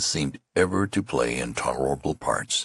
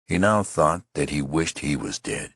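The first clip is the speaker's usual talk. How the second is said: ordinary delivery, nothing marked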